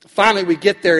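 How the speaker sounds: normal talking voice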